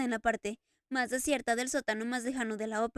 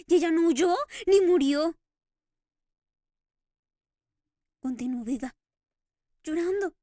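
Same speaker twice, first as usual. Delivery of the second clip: louder, overwhelmed with emotion; almost pleading with joy; tearful Eric; 3 semitones higher, 6 dB louder than usual. Acted. Eric telling the Daroga of Christine's tears, overwhelmed.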